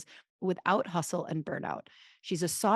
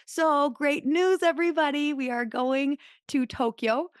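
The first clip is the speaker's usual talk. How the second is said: excited announcement; 7 semitones higher, 4 dB louder than usual. Real, not acted.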